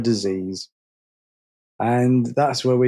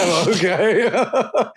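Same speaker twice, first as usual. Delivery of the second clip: laughing oh okay; 5 semitones higher, much slower than usual. Retold or imitated. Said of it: "I'm just like".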